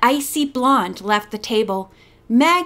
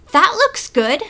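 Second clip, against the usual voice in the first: higher, politely interested; 6 semitones higher, 3 dB louder than usual. Acted. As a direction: make nice, a bit brighter. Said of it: "trying to be polite".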